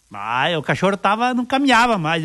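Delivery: ordinary talk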